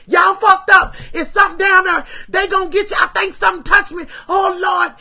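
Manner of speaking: panicked shouting. Retold or imitated. Fleeing the woods, screaming warnings to friends, panicked. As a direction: yell the warning as you flee in terror. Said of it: "I'm crying in every motherfucking thing".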